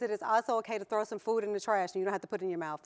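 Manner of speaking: normal talking voice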